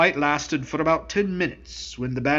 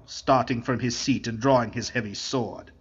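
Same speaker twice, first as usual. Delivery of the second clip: usual speaking voice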